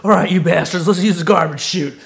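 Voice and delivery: loud, gruff mock Leia; rough tough talk. Leia dropping diplomat manners for ruffians, loud rough talk. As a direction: bark it like a scrappy rebel. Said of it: "the ruffians show up".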